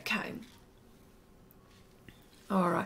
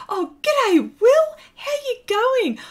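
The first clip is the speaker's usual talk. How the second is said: excited greeting; 13 semitones higher, 7 dB louder than usual. Real, not acted. Greeting a viewer by name.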